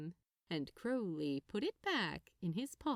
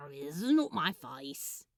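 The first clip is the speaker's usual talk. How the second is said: ordinary delivery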